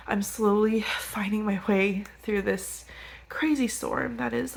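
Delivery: usual speaking voice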